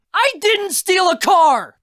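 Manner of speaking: slow, high full-voiced yell; furious shout. Raz denies car theft to uncle, furious shout. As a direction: yell it in outraged denial.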